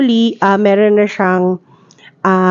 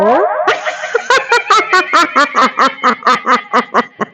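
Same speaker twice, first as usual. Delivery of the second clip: laughing through voice changer; effected laugh; 4 semitones higher than usual. Real, not acted. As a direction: laugh warped by the mic. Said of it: "Sumabra tawa".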